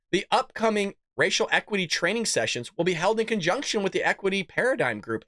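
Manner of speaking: usual speaking voice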